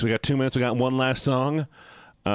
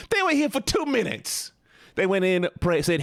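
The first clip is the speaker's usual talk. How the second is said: very high, squeaky protest; high-pitched mock outrage; indignant; 6 semitones higher than usual. Real, not acted. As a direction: squeal it in disbelief at being blamed. Defending self to chat.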